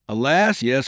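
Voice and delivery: anguished cry. A narrator's reading. Girl confessing to her lover, a mild anguished cry. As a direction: let it break out of her as grief. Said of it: "cried the poor girl".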